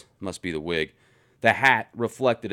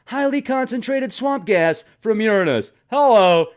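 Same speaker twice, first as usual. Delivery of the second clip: high, loud joking delivery; gleeful punchline; 9 semitones higher, 5 dB louder than usual. Real, not acted. Answering his own question with a loud gleeful joke. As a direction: land the joke with a triumphant "Hello".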